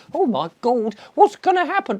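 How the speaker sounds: high, loud hammed-up panic; mock-terrified shriek. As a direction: squeal it like a terrified ninny. Mocking panicked victim of fear news.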